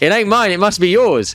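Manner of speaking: high, loud, gleeful retelling; happy relief. Retold or imitated. Man telling date the tooth is hers, gleefully. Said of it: "ran back so happy and was like".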